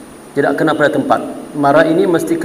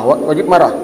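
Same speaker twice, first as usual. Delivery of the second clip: ordinary talk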